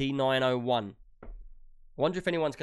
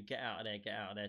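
much softer Get out of there, barely a murmur; meek not-shouting; 4 semitones lower, 14 dB quieter than usual. Retold or imitated. Imagining mild Trub warning his friend, very soft. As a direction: say it gently, not a shout at all. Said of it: "I can't imagine Trub shouting at you".

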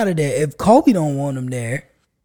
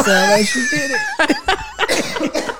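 high, slow laughing words; much slower than usual. Real, not acted. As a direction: squeal it out through laughter. Laughing through the retelling.

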